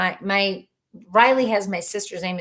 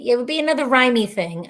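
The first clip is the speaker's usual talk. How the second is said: normal talking voice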